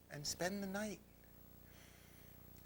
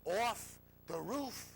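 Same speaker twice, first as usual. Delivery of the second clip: slow, higher, insistent command; commanding inner voice; 6 semitones higher, 4 dB louder, much slower than usual. Retold or imitated. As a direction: order it word by word as the voice within. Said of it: "my voice says".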